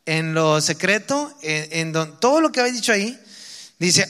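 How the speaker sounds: scripture read slowly and loudly, preaching; lectura bíblica predicada. Acted. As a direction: proclaim the verses with weight. Reading scripture aloud, preaching. (lectura de la Biblia con fuerza, pausada)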